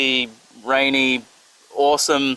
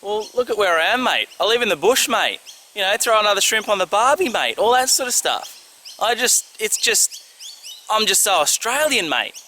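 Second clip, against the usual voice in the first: hammed-up Aussie; 6 semitones higher than usual. Answering a viewer's teasing question in a hammed-up Aussie accent. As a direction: lay the Australian on thick, mate. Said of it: "Why are you so Australian?".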